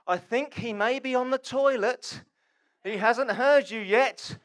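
slow, high, loud mocking taunt; sarcastic. Elijah goading prophets whose god won't answer, sarcastic. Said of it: "That's sarcasm right there".

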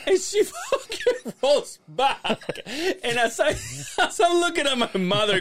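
high, squeaky laughter breaking into the words; laugh-choked storytelling, pitch way up; laughing while telling. Real, not acted. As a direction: struggle through the story, cracking up. Cracking up while telling the fall.

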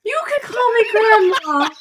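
put-on high, squeaky old gnome woman; tiny granny voice; little old grandma voice.

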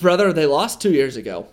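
ordinary delivery

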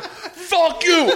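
hammed-up boyfriend yelling; high squeaky mock fuck you. Imitating angry boyfriend.

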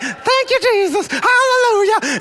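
warble it like grandma praising in church. Retold or imitated. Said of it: "and she'd be like".

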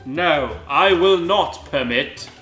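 slow, measured mild defiance; firm refusal. Acted. Snape standing up to Dumbledore, slow firm refusal. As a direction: refuse slowly and firmly. Snape defies, first reading.

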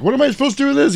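high-pitched mock bewilderment; bewildered question. Handed a gun in a retold film, mock bewildered. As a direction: squeal it like a clueless sidekick.

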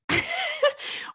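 high giggle breaking into the sentence; laughing mid-story. Real, not acted. Recalling a friend's reaction to the picture, high laughter. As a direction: giggle helplessly before you can finish.

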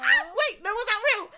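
high, loud excited squeal, laughing; thrilled disbelief. Real, not acted. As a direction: gasp it out as if you can't believe it. Son said I love you.

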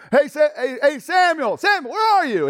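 high, insistent shout; shouting for someone. Acted. Eli hunts for his attendant, shouting high and loud. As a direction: holler for a missing servant.

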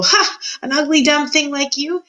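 high, jeering mockery; scornful laugh. A narrator's reading. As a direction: laugh derisively, then sneer. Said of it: "laughed Uncle".

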